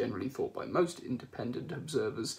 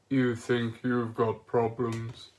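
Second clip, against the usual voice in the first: gloomy Marvin voice; 6 dB louder, much slower than usual. Acted. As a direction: sound like talking to a coffin. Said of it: "let's do the voice".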